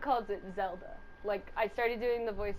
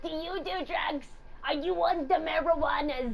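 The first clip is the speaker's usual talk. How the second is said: high, slow granny voice; quavering old lady asking; little old lady voice; 6 semitones higher than usual. Retold or imitated. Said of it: "They're like".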